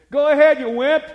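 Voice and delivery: loud mocking shout at a son; angry taunt. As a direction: yell it as a put-down. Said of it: "And I said".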